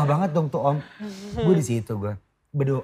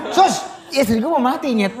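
high, panicky half-laughing cry; mock panic; 10 semitones higher, 4 dB louder than usual. Husband retells panicking at the nurse during delivery, high laughing panic. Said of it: "Susternya gue gituin".

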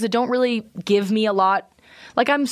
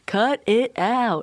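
Dave Coulier catchphrase; much slower than usual. Retold or imitated. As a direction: do the Full House catchphrase. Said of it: "Don't Dave Coulier me".

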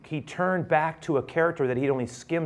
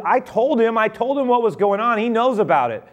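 insistent servant; 8 semitones higher, 5 dB louder than usual. Acted. Servant answers father.

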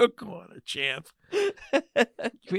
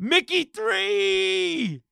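excited; 8 semitones higher, much slower than usual. Real, not acted. Excited joke shout.

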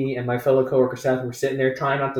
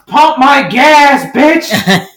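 much higher, much louder, slow drawn-out yell; drunk yell; 11 semitones higher, 13 dB louder, much slower than usual. Retold or imitated. Drunk woman yelling from the door at her friend, slow drunken bellow. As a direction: bellow it from the doorway. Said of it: "and goes".

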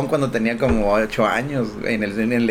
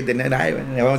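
usual speaking voice

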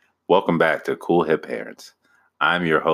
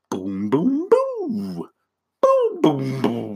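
booms leaping up very high; vocal imitation of a song's beat; imitated beat booms; 12 semitones higher, much slower than usual. Husband mimics the Whisper song's beat, high booms.

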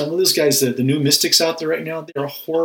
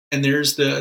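normal talking voice

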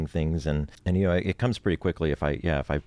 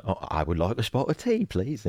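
higher-pitched British accent, repeated like practice; 5 semitones higher than usual. Shown as a demonstration. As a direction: mimic a British line back, pitched up. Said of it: "trying to say it the way they said it".

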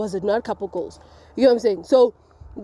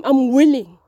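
ordinary delivery

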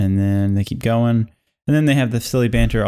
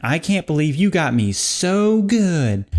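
fuming; 6 semitones higher, slower than usual. Acted.

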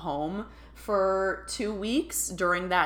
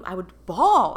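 ordinary talk, nothing marked

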